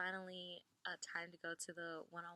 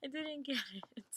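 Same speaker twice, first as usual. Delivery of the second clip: higher, laughing mock confusion; laughing realization; 8 semitones higher, 6 dB louder than usual. Told about the bus, a high laughing "oh". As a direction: laugh as it dawns on you. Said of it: "And I was just like".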